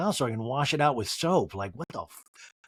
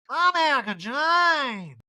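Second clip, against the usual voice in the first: high, slow mock Erica; 11 semitones higher, 3 dB louder, much slower than usual. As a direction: drawl the name, pitched up. Erica introducing herself, voiced.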